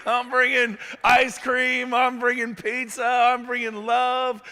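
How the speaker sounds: hyped list of good things; exuberant. Real, not acted. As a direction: sell it like a party's arriving.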